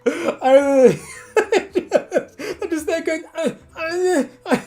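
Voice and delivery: breaking into laughter; laughing. Real, not acted. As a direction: laugh through the words. Laughing mid-story.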